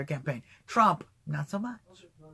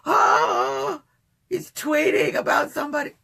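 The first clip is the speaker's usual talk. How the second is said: high-pitched fretting with loud, drawn-out "oh"s; hammed-up alarmed wailing; mock panic; 7 semitones higher, 5 dB louder than usual. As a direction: imitate a nervous worrier overreacting to everything. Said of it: "gonna make 'em panic".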